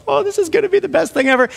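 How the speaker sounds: put-on high, fast, giddy kid voice; gleeful kids. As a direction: squeal it like a kid who can't wait. Kids thrilled about their prank.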